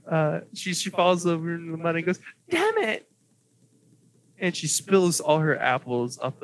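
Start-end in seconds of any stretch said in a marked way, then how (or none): 2.5-3.1 put-on high light elven girl's curse. GM redoing the elf girl's curse, high and dainty. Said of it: "I did the wrong voice".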